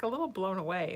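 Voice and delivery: ordinary talk, nothing marked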